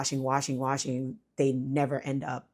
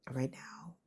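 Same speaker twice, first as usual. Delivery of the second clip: quick real whisper, voice failing; whispering so the sleeping son stays asleep; 11 dB quieter than usual. Real, not acted. Sick mother talking to viewers with a fading voice, whispering. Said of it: "I'm losing my voice a little bit".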